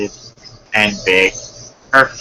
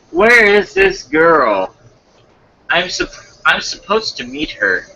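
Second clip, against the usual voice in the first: lightly voiced story character; 13 semitones higher than usual. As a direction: pitch it up and draw it out. Voices the story's character, false start.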